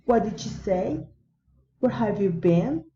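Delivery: polite, interested lift on "say" and "been"; friendly rising intonation. Shown as a demonstration. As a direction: swing "say" and "been" upward. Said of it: "rising intonation".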